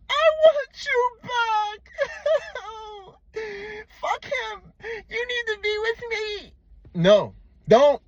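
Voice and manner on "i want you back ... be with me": very high, slow wailing beg; mock whiny crying. Mocking how a jealous man sounds to her, high and weepy. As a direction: overdo a weepy falsetto plea. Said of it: "You sound like this".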